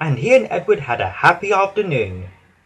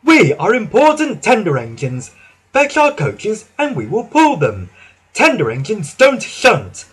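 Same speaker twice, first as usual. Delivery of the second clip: higher, louder, haughty engine; huffing; 7 semitones higher, 4 dB louder than usual. Acted. Henry huffily refusing to shunt, higher and louder. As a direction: huff it out, proud and offended. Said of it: "huffed Henry".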